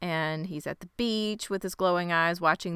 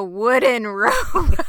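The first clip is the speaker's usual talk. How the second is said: louder, slow, cracking up on the words; laughing; 5 semitones higher, 8 dB louder, slower than usual. Real, not acted. Friend joking about a fire in a boat, laughing. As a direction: laugh through the punchline, stretching it out. Cracking up over absurd scene.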